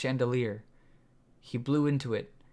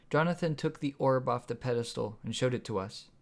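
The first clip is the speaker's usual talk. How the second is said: usual speaking voice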